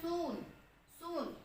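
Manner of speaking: सून with a long ऊ; long vowel. Shown as a demonstration. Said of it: "यहाँ हम जो long ऊ है".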